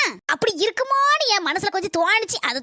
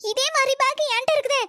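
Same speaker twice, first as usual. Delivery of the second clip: ordinary talk, nothing marked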